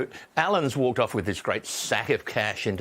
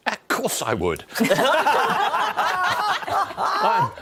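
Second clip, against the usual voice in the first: bursting into laughter mid-answer; laughing; 4 dB louder, much slower than usual. Real, not acted. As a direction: laugh through the words, amused. Laughing at the job question.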